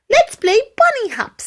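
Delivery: high, eager bunny voice; excited little bunny. Acted. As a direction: squeal it like a bunny ready to play. Jumped up excitedly.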